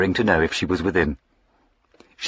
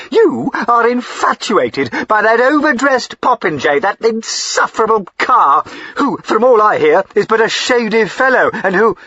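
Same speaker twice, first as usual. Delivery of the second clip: much higher and louder jealous rant; jealous tirade; 13 semitones higher, 6 dB louder than usual. A narrator's reading. Jealous squire rails at Diana about Carr, furious. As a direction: spit out every insult at the rival.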